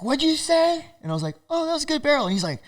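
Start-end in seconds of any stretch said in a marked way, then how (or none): 0.0-0.8 high-pitched challenge from an angry local; mock confrontation. 1.5-2.2 high, meek appeasing voice; mock placating. His placating reply to the local.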